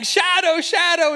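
put-on boy calling his dog home; retold yell. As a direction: call out across the hill to the dog. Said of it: "Peter is yelling".

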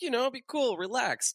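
high, mock placating; Deadpool voice. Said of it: "And he's like".

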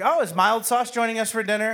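high, mock-delighted taunt; teasing family. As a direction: needle him with the nickname. Family teases him with nickname.

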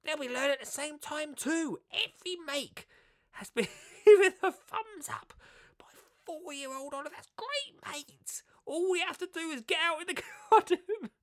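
very high put-on voice, laughing through it; hammed-up Matt Biggs read; Matt Biggs impression. Reading Matt Biggs' blurb to viewers, in a very high hammed-up voice. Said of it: "I won't do the others in the voice".

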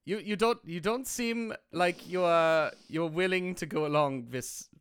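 put-on German-accented doctor giving orders; measured mock-German reassurance. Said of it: "if I had a German accent".

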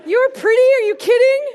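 high, loud incredulous scoff; scoffing disbelief. Retold or imitated. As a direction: scoff at the fortune cookie in disbelief. Mocking the fortune cookie.

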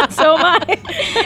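laughing. Real, not acted. Laughing reply.

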